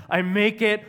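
normal talking voice

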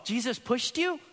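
put-on high, curious toddler question; little daughter's voice. Pastor voicing his daughter's odd claim, high toddler voice. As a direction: sound like a wide-eyed two-year-old.

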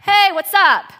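breezy hail to a buddy; casual. Acted. Preacher showing what Jesus' call is not, casual. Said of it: "It's not this casual".

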